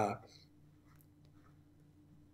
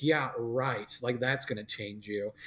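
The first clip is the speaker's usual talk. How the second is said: sarcastic dad; 5 semitones lower, 7 dB quieter than usual. Retold or imitated. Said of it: "rolls his eyes".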